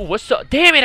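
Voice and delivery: ordinary delivery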